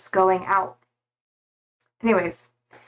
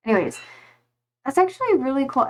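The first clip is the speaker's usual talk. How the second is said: usual speaking voice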